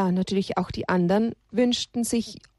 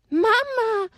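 high desperate call of Mama; little donkey shouting after his mother; child's cry for mama; 13 semitones higher than usual. Acted. Little donkey calling after his captured mother, high desperate cry. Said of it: "schrie Haberle".

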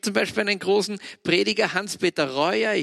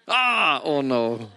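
startled oh no; 2 semitones lower, much slower than usual. Real, not acted. Reacts to something going wrong.

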